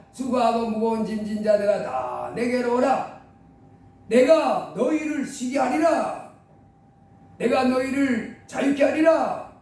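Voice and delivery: slow, weighty invitation; solemn call of Jesus. Acted. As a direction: let each phrase land slowly. (예수님이 부르시는 느리고 무게 있는 말투)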